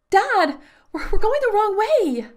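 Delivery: high, loud frantic cry; panicked cry. A narrator's reading. Daughter cries to father running away, panicked. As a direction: cry out in alarm. Child panicking while carried.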